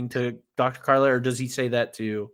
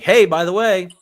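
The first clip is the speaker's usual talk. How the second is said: loud, higher-pitched announcement; mock-brash, raised-voice quote; storming in; 6 semitones higher, 6 dB louder than usual. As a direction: blurt it out loudly, acting out her entrance. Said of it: "storms in, and she's like".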